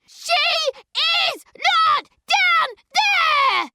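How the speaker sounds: very high, loud, slow piercing scream; girl screaming at the grave. Acted. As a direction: scream it from the gut. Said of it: "and she screams".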